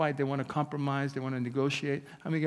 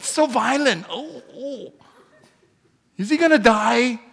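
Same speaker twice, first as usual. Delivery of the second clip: put-on high, louder squeal of a squeamish kid watching a film; imitating daughter; 8 semitones higher, 9 dB louder than usual. Imitating his daughter wincing at Braveheart, high and squeamish. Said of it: "and she's like".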